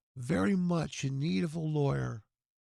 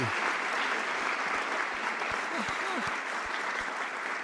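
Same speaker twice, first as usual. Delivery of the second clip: breathy laugh; 11 semitones higher than usual. Real, not acted. Laughs after greeting friend.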